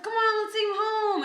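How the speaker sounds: high, whiny plea to the friends; mock pleading. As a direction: wheedle like a drunk begging friends. Begging friends to take him.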